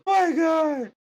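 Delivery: high-pitched cry of disbelief; shocked exclamation. Real, not acted. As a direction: burst out, appalled at what you just read. Host reacts to the killing.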